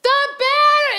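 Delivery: high, calling yell from another room; husband yelling. Retold or imitated. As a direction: holler for the wife. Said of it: "scream my name for five minutes".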